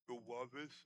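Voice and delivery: soft, low mumble imitating a stroke patient; words barely formed; slurred stroke speech. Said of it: "Fala direito".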